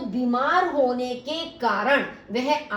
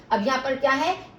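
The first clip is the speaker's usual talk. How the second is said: normal talking voice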